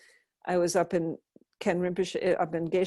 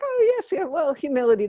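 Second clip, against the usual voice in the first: giggly monk; 7 semitones higher, 4 dB louder than usual. Retold or imitated. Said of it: "And he started giggling".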